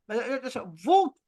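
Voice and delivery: normal talking voice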